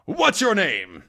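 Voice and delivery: high-pitched, held-back yell; mock yell. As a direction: raise the pitch as if yelling. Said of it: "He yells at him".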